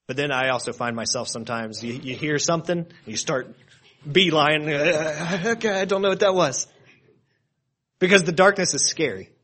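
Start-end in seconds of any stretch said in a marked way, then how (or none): 4.1-6.7 a jumpy, startled higher voice in a hurry; mock startled. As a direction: act out getting spooked by a sound in the dark. Startled by a noise in the dark.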